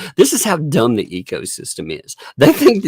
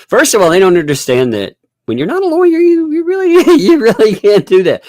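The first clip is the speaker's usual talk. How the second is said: laughing; 9 semitones higher, 5 dB louder, faster than usual. Real, not acted. Laughing while explaining.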